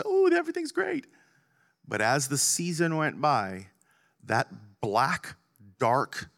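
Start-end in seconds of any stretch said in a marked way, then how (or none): 0.0-1.1 mock cheerful. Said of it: "and then I was".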